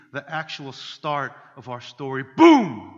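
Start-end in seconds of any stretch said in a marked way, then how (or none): 2.4-2.9 loud high exclamation; dramatic boom. Pretend. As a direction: announce it like a movie title. Dramatic title moment.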